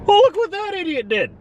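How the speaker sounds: squeaky busybody voices, hammed up; imitating gawking bystanders. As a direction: ham up the rubberneckers turning to look. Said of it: "so everyone else looks".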